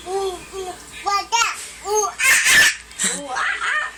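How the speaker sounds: high ooh-ah monkey call; monkey noises. Shown as a demonstration. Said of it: "What does a monkey sound like?".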